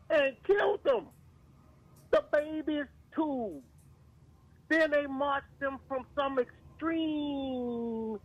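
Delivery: choked up and crying on the words; real tears breaking through the telling. Real, not acted. Telling the group about the killings, voice breaking. Grieving over killed Native Americans.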